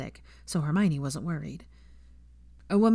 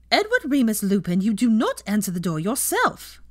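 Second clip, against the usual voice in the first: put-on louder, stern scolding old woman; stern grandmother; 5 semitones higher, 6 dB louder than usual. Grandmother scolding Teddy for opening the door, stern. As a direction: scold the boy by his full name. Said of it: "said a stern voice".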